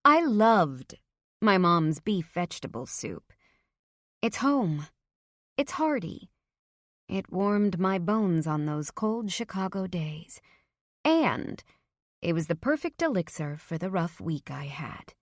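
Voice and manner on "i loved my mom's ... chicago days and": ordinary delivery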